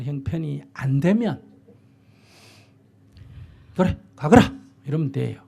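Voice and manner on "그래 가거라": loud mock permission. Parent calmly letting child go.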